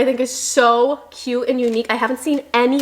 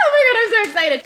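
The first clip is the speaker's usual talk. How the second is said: excited shout; 10 semitones higher, much faster than usual. Real, not acted. Thrilled at finding matching pants, high excited shout. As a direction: shout it out in pure delight.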